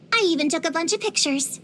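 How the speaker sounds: ordinary talk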